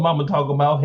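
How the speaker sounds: usual speaking voice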